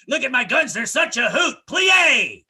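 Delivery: high, loud flamboyant marching chant; hammed-up drill call; camp drill sergeant. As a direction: call the cadence with sass. Drill sergeant cadence mocked.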